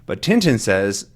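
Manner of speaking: usual speaking voice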